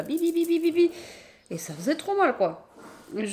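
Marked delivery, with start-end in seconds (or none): 0.0-0.9 quick high "bi bi bi" imitating stinging needles; needle prick noises. Telling viewers about stinging after the shower, quick high pricking sounds. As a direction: mimic tiny needles jabbing the skin. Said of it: "des aiguilles comme ça".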